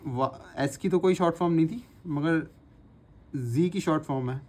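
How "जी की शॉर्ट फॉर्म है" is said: ordinary talk, nothing marked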